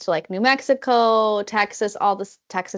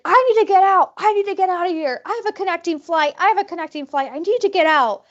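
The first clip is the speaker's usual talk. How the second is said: mock frantic yelling; 6 semitones higher than usual. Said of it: "starts yelling at the dad".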